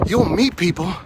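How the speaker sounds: normal talking voice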